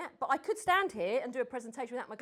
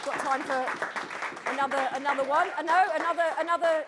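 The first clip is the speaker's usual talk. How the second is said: louder, higher call across the room; 5 semitones higher, 10 dB louder, faster than usual. Real, not acted. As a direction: raise the voice to reach the back of the room. Host calling across the room.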